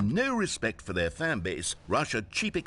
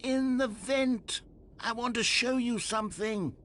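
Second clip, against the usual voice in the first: calling out; 10 semitones higher, slower than usual. Acted. Narrator calls from the vent.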